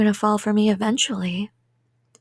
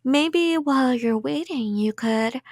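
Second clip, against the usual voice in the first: usual speaking voice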